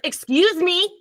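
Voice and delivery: high, drawn-out mock indignation; sassy indignant put-on. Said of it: "Not that I talk like that".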